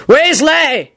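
high, booming summons; commanding, outraged call; bellow. A narrator's reading. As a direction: bellow the surname in authority. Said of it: "he bellowed".

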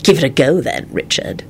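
controlled, cold challenge. A narrator's reading. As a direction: dare him coldly, dropping away at the end. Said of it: "he responded coldly".

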